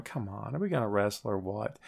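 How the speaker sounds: low, soft deadpan imitation; Big Show. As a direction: grumble it low and quiet, unimpressed. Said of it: "Big Show just kinda standing there going".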